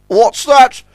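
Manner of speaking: high, loud, flustered voice; panicky Top Hat. A narrator's reading. Top Hat sees lights ahead while lost, panicky. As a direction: fluster into a bewildered outcry.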